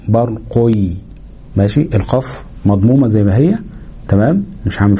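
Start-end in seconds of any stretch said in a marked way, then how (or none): 0.1-0.9 u then y glide closing برقي; tanween turned yaa. Shown as a demonstration. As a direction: say برقي as "barquy", ending on y. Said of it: "هقلبها الياء".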